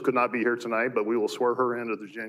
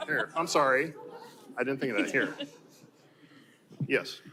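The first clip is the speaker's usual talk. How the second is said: ordinary talk, nothing marked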